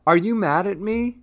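high worried question, rising; anxious exclamation. A narrator's reading. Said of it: "and exclaimed".

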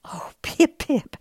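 slow, airy amused laugh; mother laughing at her chick's boast; laughing fondly. Acted. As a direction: laugh lovingly at the little one. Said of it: "laughed his mommy".

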